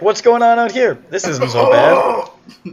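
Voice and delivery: loud, high mock-clueless voice; oblivious character. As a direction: pipe up high and loud, blithely curious. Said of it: "you're like".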